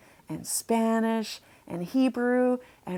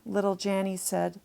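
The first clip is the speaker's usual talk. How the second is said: ordinary delivery, nothing marked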